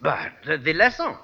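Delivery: phony French professor voice; French accent. Acted. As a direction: play the suave Frenchman. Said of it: "this phony French accent".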